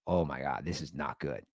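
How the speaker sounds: low, hushed aside to yourself; retold quiet dread; sinking realization. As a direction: say it very softly and low. Retells inner dread at the news.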